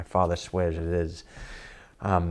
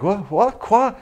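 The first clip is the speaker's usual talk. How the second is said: high, louder, bewildered French; baffled Frenchman; 12 semitones higher, 5 dB louder than usual. Retold or imitated. Woken Frenchman baffled by angry stranger, high and bewildered. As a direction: rise sharply on quoi, lost and confused. Second French guy confused.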